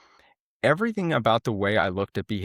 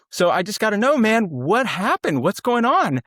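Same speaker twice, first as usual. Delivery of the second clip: much higher, louder, pressing him to explain; urgent pleading; 9 semitones higher, 4 dB louder than usual. Retold or imitated. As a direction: ask anxiously and urgently what happened. Manager pleading with angry Adam.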